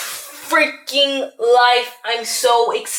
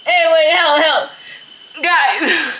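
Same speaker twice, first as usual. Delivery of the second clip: mock cry for help; 6 semitones higher, 4 dB louder, much slower than usual. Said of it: "Just kidding".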